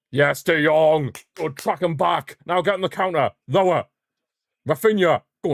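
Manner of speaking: high, loud, urging on the counter; will the attack forward; excited cheering. Real, not acted. Watching the counter, cheering his team on excitedly.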